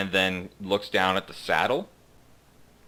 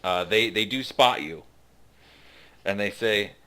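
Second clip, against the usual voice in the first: normal talking voice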